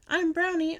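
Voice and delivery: high, loud, proud announcement; joyful shout. A narrator's reading. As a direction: shout your name out with joy. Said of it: "I shouted".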